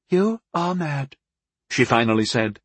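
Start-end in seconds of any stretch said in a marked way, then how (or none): 0.1-1.2 slow, high, dismayed verdict; sad disbelief. A narrator's reading. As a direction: pronounce it slowly in sorry disbelief. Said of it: "shaking her pretty head sadly".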